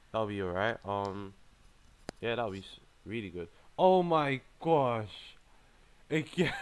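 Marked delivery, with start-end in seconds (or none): 3.8-6.5 loud, drawn-out exclamation; exasperated. Real, not acted. Player drawn against the same opponent, exasperated. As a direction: stretch it high and loud in disbelief.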